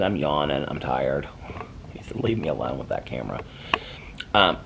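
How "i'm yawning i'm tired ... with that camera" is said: lower, softer, lightly voiced tired dog; sleepy dog voice. Owner voicing her yawning dog, low and sleepy. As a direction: grumble low like a pup wanting rest.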